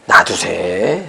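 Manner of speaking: low, lightly acted divine voice; God's deadpan reply. God answering the man who counted his offering, low mock-solemn. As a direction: drop low and draw out the punchline.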